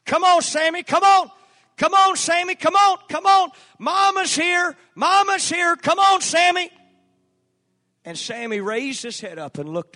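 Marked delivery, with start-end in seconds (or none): 0.0-6.7 very high, loud coaxing call; calling the dog. Retold or imitated. Mother calling Sammy across the meadow, high and loud. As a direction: call him home across the field.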